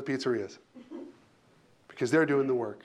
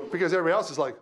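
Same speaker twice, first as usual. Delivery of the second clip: ordinary talk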